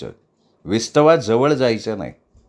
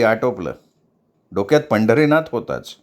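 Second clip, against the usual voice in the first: ordinary delivery, nothing marked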